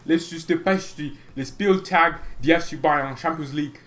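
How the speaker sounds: stumbling, made-up German in a hammed-up accent; badly faked German accent, garbled and pushed. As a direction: fake your way through German. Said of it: "I can't do the German accent".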